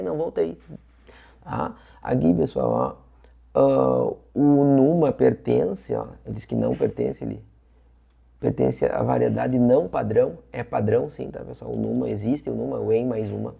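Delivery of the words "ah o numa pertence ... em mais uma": ordinary talk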